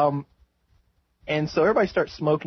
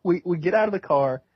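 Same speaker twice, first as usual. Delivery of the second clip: ordinary talk, nothing marked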